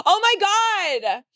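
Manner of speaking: high, slow oblivious friend voice; high and drawn-out oblivious caller; speakerphone friend. Retold or imitated. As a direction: play the clueless friend on speaker. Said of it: "And you're like".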